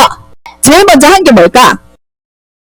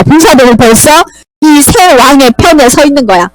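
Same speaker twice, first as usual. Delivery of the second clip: ordinary talk, nothing marked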